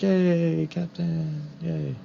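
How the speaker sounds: weary, drawn-out cheer; a tired half-hearted hooray; tired sailor voice. Acted. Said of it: "And she's like".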